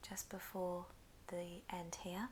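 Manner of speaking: normal talking voice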